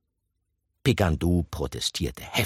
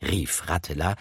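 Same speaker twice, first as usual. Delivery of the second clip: usual speaking voice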